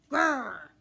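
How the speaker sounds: loud, cross puppy growl; dog growl. Acted. Dog-girl growls at dress.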